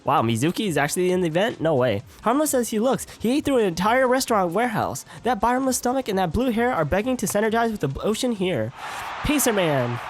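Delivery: game announcer. Acted. Host presents Mizuki to the crowd, announcer style. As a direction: announce the next contestant with showmanship.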